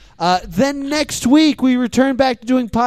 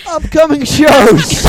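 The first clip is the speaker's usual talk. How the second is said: loud, slow, raised announcer shout; announcer call; 5 semitones higher, 7 dB louder, slower than usual. Real, not acted. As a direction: stretch it out like a show announcer. Host announces plugs section.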